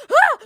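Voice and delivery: sudden gasped "Ah!"; short mock shriek of alarm; startled yelp. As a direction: imitate a jumpy dog's frightened cry. Dog startles and cries out.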